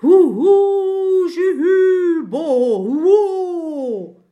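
high, loud, wavering ghost howls; spooky ghost wailing. Acted. Ghost circling the friends' heads, howling high and loud. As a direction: wail like a ghost circling overhead. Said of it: "gibt die gruseligsten Töne von sich".

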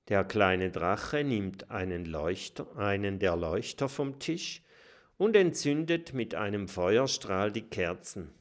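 ordinary delivery

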